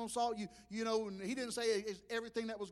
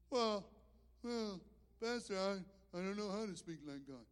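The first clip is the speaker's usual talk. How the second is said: timid churchgoer; 4 semitones lower, 6 dB quieter than usual. Retold or imitated. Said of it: "you need to learn how to speak".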